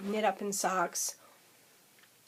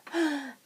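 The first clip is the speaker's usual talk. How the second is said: gasp; 7 semitones higher than usual. Retold or imitated. Retold reaction to yarn.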